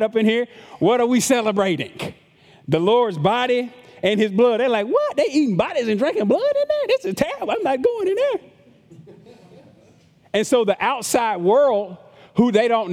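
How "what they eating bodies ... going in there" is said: high, mock-horrified outsider; high appalled gossip voice. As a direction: shriek it like a scandalized onlooker. Outsiders misread the Lord's Supper.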